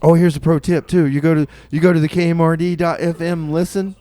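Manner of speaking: ordinary delivery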